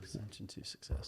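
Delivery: breathy whisper of the slogan Ascension to success. Acted. Speaker echoing the slogan to the crowd in a whisper. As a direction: hush it like a secret mantra. Phrase repeated back as whisper.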